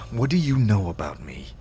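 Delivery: ordinary talk, nothing marked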